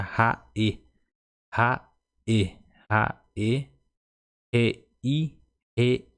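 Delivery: "raê" and "rei" each cut in two, ra-ê, re-i; a clean break inside every "raê" and "rei"; separated syllables. Shown as a demonstration. Teacher drills the apostrophe sound for learners, syllables cut apart. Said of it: "reparem que a pronúncia seria".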